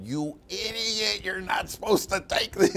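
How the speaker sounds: high, stretched-out imitation of a wife; hammed-up high-pitched telling-off; mock frantic scolding. Wife jumping up and down at swollen husband, mock frantic scolding.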